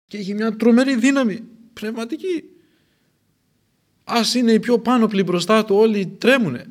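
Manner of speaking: high and loud fervent voice; impassioned preaching. Real, not acted. Preacher marvelling at John's power, high and loud. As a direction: swell with awe at his power.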